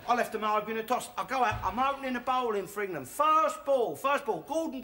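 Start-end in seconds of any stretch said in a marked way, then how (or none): none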